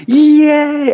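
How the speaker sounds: high, loud imitated cheer; crowd cheer. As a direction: cheer like a whole audience seeing the star. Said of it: "everyone's going".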